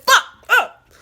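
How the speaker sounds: squealed high and loud; high yelled exclamation. Retold or imitated. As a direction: shriek it out. I was like.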